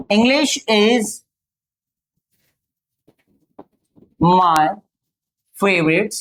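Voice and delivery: very slow, word-by-word English sentence; slow model answer. Shown as a demonstration. Teacher dictating an answer slowly to students. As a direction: dictate it slowly, pausing between words. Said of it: "एक और तरह से कहा जा सकता है".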